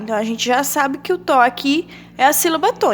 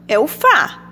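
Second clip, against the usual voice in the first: normal talking voice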